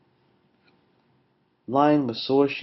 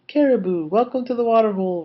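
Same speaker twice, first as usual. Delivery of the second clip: high, loud, fast welcome; roaring; 10 semitones higher, 6 dB louder, faster than usual. A narrator's reading. Lion welcomes animals to the water hole, roaring. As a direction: roar out a joyful welcome. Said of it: "roared Lion".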